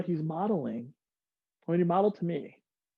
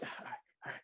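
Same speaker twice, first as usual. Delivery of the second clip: whispered psych-up; 17 dB quieter than usual. Retold or imitated. Jumper gearing up to jump.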